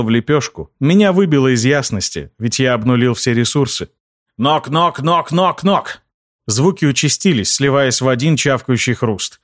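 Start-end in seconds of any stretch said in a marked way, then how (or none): 4.4-6.0 rising knock knock, higher each time; knocking sound. A narrator's reading. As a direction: tap it out like knocks climbing up. Knocking sound imitated by narrator.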